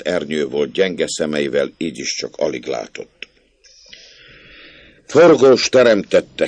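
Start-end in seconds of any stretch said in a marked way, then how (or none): 5.1-6.5 slow, loud, higher oath; shouted curse. A narrator's reading. Old man shouts a curse at the barefoot boy. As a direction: bellow the curse at him. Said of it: "kiáltott rá".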